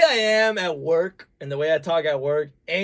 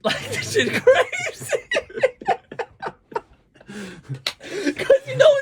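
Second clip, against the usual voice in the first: helpless high laughter through the words; real laughing fit, words stretched out; cracking up; 5 dB louder, much slower than usual. Real, not acted. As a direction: talk through a big laugh. Cracks up at own bit.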